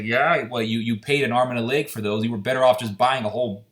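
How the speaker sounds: normal talking voice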